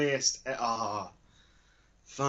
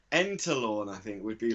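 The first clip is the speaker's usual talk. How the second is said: ordinary talk, nothing marked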